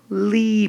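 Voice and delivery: "lee" held out high; single out "lee" as a lifted, stretched syllable; stressed syllable isolated. Shown as a demonstration. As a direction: draw out "lee" on a high pitch. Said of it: "Would we stress the high part".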